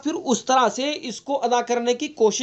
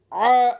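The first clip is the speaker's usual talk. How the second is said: choked, forced throaty aah; strained throat sound; 3 dB louder than usual. Shown as a demonstration. Teacher demonstrates the wrong choked ain sound, strained. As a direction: squeeze the throat on a harsh aah. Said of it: "اس طرح کی آواز نکال کر کے آپ کو بتا رہا ہوں".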